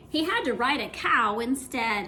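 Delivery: plain reading voice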